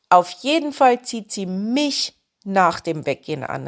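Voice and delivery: usual speaking voice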